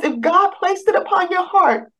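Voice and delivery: lightly voiced calm counselor reassuring her; soothing advocate.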